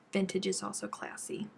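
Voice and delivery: usual speaking voice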